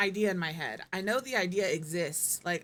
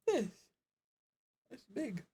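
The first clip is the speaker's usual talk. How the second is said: yawning; 4 semitones higher than usual. Real, not acted. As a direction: talk through a yawn. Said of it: "The yawn was".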